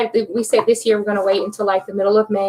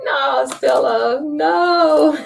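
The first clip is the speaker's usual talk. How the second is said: laughing no; 5 semitones higher, much slower than usual. Real, not acted. Teasing the viewer, laughing.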